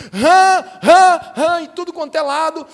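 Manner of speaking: loud high bursts on each repeated word; exclaimed alarm. Acted. Preacher showing frogs everywhere, loud dramatized alarm. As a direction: punch each word out like a sudden sighting.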